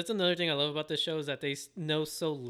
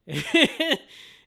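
high, loud burst of laughter; real high-pitched laughing; 12 semitones higher, 11 dB louder than usual. Real, not acted. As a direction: crack up after the impression. Laughs after imitating the dad.

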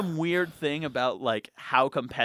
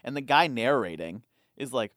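ordinary delivery

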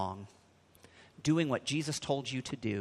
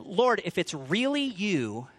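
higher, slow call across the water; 6 semitones higher, slower than usual. A narrator's reading. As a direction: call out to the Lord, drawn out. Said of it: "Then Peter called to him".

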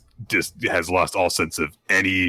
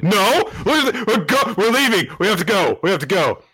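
much higher, panicky hurried exit; flustered retreat; 11 semitones higher, 3 dB louder than usual. Retold or imitated. Damien fleeing his crew's question, high flustered voice. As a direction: bluster your way out fast. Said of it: "He's like".

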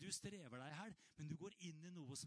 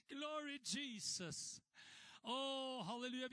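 louder, higher halleluja shout; ecstatic praise; 7 semitones higher, 4 dB louder, slower than usual. Real, not acted. Preacher shouting praise to the congregation, higher and louder. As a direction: burst out in praise.

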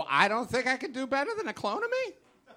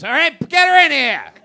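loud, fast frantic shouting, laughing through it; angry rant; 4 semitones higher, 10 dB louder than usual. Acted. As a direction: bellow it like an unhinged husband. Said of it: "he sounded really angry and really upset".

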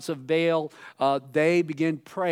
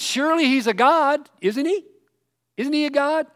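high, louder sarcastic taunt; mocking; 9 semitones higher, 6 dB louder than usual. Elijah mocking the prophets of Baal, sarcastic raised voice. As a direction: sneer at their god with fake wonder. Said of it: "Then he says".

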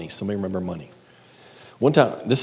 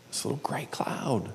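whispered, falling dread; storyteller's hushed cloud; ominous whisper; 4 semitones higher, 8 dB quieter than usual. Real, not acted. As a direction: breathe it like a shadow.

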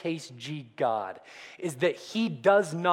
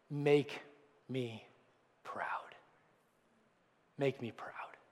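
soft breathy whisper, each word separated by pauses; hushed plea; 6 semitones lower, 12 dB quieter, slower than usual. Retold or imitated. Father on porch whispers to son leaving for war, hushed. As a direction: whisper it like a father's heavy blessing.

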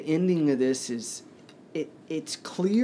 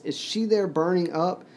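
higher, urgent question, mildly raised; 6 semitones higher than usual. A narrator's reading. Child asks about his dead mother, in a mildly raised voice. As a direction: lift the pitch into an anxious question. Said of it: "he said in a loud voice".

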